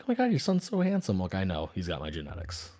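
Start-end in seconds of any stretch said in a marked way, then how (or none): 0.0-1.2 higher, gushing shopper voice; gushing stranger. Retold or imitated. Strangers at Walmart fawning over his son, mock gushing. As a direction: coo over the kid like an adoring stranger. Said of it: "And everyone's like".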